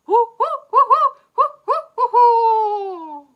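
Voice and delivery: high, loud owl hooting; imitated owl hoots. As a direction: hoot like an excited barred owl. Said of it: "He's like".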